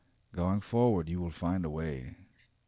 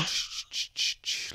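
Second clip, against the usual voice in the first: hushing shh; slower than usual. Acted. Villain hushes panicking player character.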